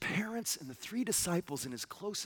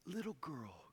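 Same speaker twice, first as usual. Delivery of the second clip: soft tender; 2 semitones lower, 10 dB quieter than usual. Shown as a demonstration. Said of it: "You could translate it little lamb or".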